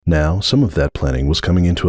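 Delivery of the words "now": ordinary delivery, nothing marked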